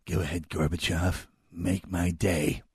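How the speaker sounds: menacing movie-hero line, dragged out; Reagan as Clint Eastwood. Retold or imitated. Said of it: "it always winds up sounding like Clint Eastwood".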